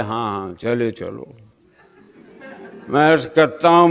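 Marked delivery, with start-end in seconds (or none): 0.0-1.4 low, soft brush-off; offhand assent. Acted. God answers the pleading worshipper, quietly dismissive. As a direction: murmur it low, waving the petitioner on. God's brief answer to plea.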